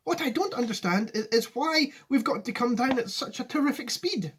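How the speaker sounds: high-pitched, puzzled question. A narrator's reading. Nervous boy questioning Wonka above noise, high-pitched call. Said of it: "he yelled above the noise".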